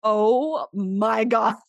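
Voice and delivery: laughing in disbelief. Retold or imitated. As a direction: laugh it out, stunned. Doctor's stunned laughing reply.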